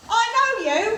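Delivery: high, loud excited exclamation; shop lady excited. Retold or imitated. Shop assistant recognising the comedian, excited high exclamation. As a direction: shout it like spotting a celebrity. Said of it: "she goes".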